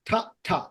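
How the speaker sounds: short, separate aspirated "ঠা, ঠা"; T sound demo. Shown as a demonstration. Teacher shows students the T sound, isolated aspirated "ঠা". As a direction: say "ঠা, ঠা" as two crisp isolated T sounds. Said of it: "আর টি এর sound এইভাবে".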